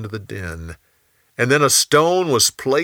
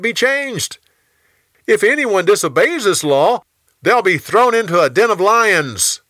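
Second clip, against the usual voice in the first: high, loud proclamation to a king; fawning officials; 9 semitones higher, 5 dB louder than usual. Acted. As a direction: pitch it high and strong, addressing royalty.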